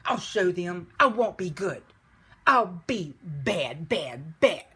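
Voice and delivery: loud, emphatic wolf threat; terrible temper. A narrator's reading. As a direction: punch each word, slowing down on the repeats. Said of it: "in a terrible, terrible temper".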